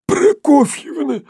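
high, slow, hoarse gasp. A narrator's reading. Sharikov, choked, repeats the name gasping and hoarse. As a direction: gulp air and rasp the name. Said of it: "перехватывая воздуху охрипший".

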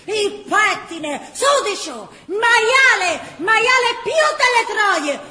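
high, loud screaming insults; aunt beating the boy, shouting furious insults. Acted. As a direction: scream it at him, beside herself. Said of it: "verciava la zia".

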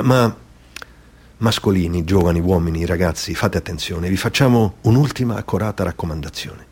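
ordinary delivery, nothing marked

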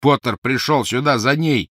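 losing patience with a dimwit; barely hidden annoyance. Acted. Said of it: "с плохо скрытой досадой".

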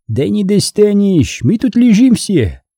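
high, jaunty call; high-pitched cheery holler; cheerful shout. A narrator's reading. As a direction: taunt him merrily from cover. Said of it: "весело крикнул Абай".

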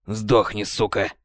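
hoarse wheeze. Acted. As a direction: wheeze it out, dying. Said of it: "прохрипел рядом голос Бойда". (хриплый, сдавленный голос)

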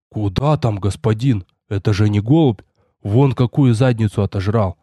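low-pitched dismissive hunter; hunter's voice. A narrator's reading. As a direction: wave the idea away in a low voice. Hunter answering his master.

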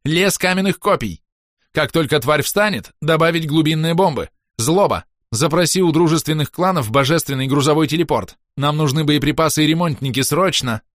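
high, urgent commands; battle orders. A narrator's reading. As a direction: fire off orders to the crew under attack. Captain shouting battle orders. (срочные приказы, высоким голосом)

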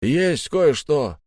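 high, drawn-out call. A narrator's reading. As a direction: call slowly across a distance. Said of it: "крикнул откуда-то сзади Грег".

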